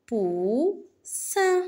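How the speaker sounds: "Poussin" split into pou, ssin; syllable by syllable. Shown as a demonstration. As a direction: hold "pou", pause, then "ssin" in "Poussin". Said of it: "Frappe les syllabes". (« Poussin » en deux syllabes bien séparées)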